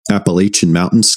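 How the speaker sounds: normal talking voice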